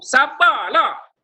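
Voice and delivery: loud, high, stretched-out scold; angry demo. Shown as a demonstration. Teacher showing sabar said with the wrong intonation, angry. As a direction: snap for patience, dragging the word out. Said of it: "dari sudut intonasi suara kita".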